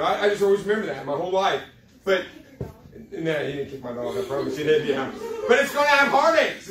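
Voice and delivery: usual speaking voice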